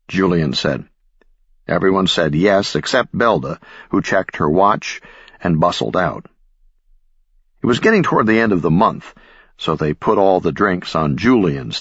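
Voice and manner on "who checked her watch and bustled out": plain reading voice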